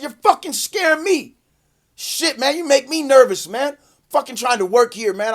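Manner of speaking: high-pitched agitated comeback; retold indignant retort. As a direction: throw it back at the cops, worked up. Retold arguing back at the cops.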